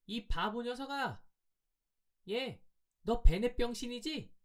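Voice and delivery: put-on very high-pitched girl's voice; high, drawn-out jeer meant for his ears only; taunting girl. A narrator's reading. Girl jeering behind the boy's back, taunting. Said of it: "나에게만 들릴 듯 말 듯한 음성으로".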